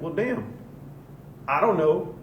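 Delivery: a caught-out shrug of a voice. Retold or imitated. Racist gives up.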